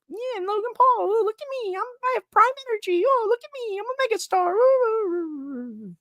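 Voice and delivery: put-on very high, squeaky bratty kid voice; mock him as a show-off little kid; mocking Logan Paul. Mocking Logan Paul as a bragging little kid, very high and squeaky. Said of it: "In my mind, I'm just like".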